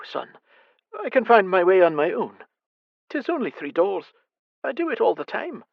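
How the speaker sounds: the fretful mother at night; anxious blind mother. Acted. Mother insists she goes alone.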